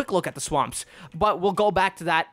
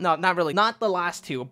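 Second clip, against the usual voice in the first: ordinary talk, nothing marked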